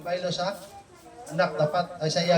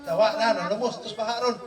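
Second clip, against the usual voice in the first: ordinary talk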